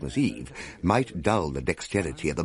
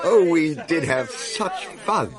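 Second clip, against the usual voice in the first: delighted memory; 10 semitones higher, 4 dB louder, slower than usual. A narrator's reading. Narrator fondly remembers the party.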